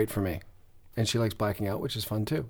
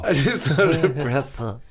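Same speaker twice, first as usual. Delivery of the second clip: laughing. Acted. Echoing friend's line, laughing.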